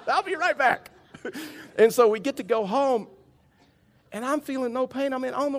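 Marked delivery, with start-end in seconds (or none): 0.1-0.9 higher, louder, laughing as he speaks; laughing through words. Real, not acted. Pastor finishing his bathroom joke while laughing.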